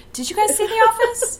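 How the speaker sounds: high-pitched incredulous query; high, put-on disbelief; high mock question. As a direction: squeak it out in disbelief. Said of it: "I was just kind of like".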